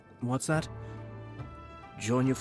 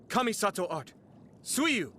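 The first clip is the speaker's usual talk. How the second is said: high, forceful attack name; commanding high-pitched combat cry; battle technique call; 9 semitones higher than usual. Acted. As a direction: call out your special move, pitched high.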